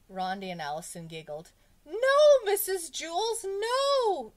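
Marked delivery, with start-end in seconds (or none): 1.8-4.3 very high, loud, drawn-out scream; panicked schoolboy shriek. A narrator's reading. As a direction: scream in horror at the teacher. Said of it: "Jason screamed".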